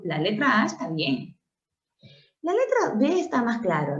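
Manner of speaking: ordinary talk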